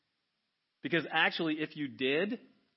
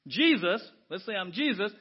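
ordinary talk, nothing marked

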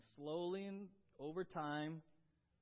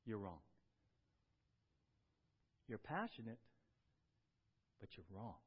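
firm gentle rebuke; 8 semitones lower, 7 dB quieter than usual. Retold or imitated. Jesus firmly correcting Martha, low and tender. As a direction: lower and softer, loving but firm. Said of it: "lovingly but firmly in love corrects her".

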